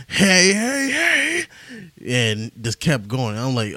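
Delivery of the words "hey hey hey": high, drawn-out mimicked holler; imitated loud yell. Podcaster imitating Cosby yelling outside the courthouse, mock loud yell. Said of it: "he just yelled out real loud".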